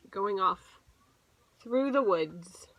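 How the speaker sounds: ordinary delivery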